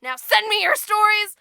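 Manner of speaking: loud, playful call-out to a friend; single out Kayla loudly, half-joking; loud teasing shout at a listener; calling someone out. Real, not acted.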